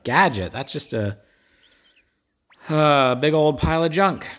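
hammed-up jeering voice; mocking insult. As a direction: scoff at the robot. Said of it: "And they're like".

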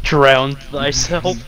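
slow, solemn mock pronouncement; mock archaic decree. Friend taunting player near water, slow mock-solemn decree. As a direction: intone it like an old curse.

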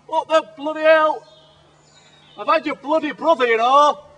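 loud, high, raging yell; furious shout. Real, not acted. Survivalist raging at the snapped bow with a loud, high yell. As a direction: blow up at the broken bow.

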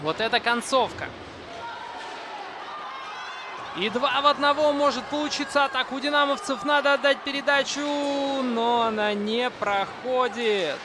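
high, loud, tense finish; excited commentary. Real, not acted. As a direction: build the tension of the final attack. Tense finish of the hockey match.

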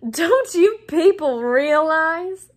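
high, loud, drawn-out talking down; patronising, theatrical slowness; slow condescension. A narrator's reading. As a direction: speak slowly as if to dimwits. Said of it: "speaking slowly, as though they were all a bit dim".

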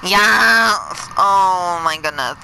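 high loud victory yell; triumphant shout. Real, not acted. Player cheering at the boss fight, loud and high. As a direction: scream for joy.

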